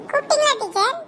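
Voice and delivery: high schoolgirl voice, rising question; pupil caught unawares. Acted. Sheela asks if teacher called her, high pupil voice. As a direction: ask in a high child's voice.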